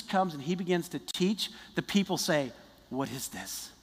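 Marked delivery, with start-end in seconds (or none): 2.9-3.7 low, soft, awed question; a quiet, low gasp of amazement; hushed wonder. Acted. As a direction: marvel under your breath. People hear Jesus teach.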